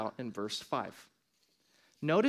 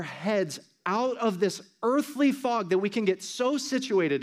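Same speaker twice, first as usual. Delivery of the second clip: higher, fervent street preaching; street preacher; 5 semitones higher, 4 dB louder than usual. Real, not acted. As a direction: urge them on like a street preacher. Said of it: "if I sound like a crazy street preacher".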